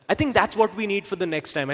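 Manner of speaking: ordinary talk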